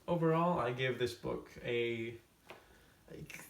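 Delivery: ordinary talk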